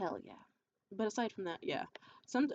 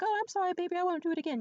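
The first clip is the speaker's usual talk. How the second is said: high, louder imitation of an apologetic boyfriend; over-eager placating boyfriend; mock apology; 9 semitones higher, 4 dB louder, faster than usual. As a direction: apologize in a put-on higher voice.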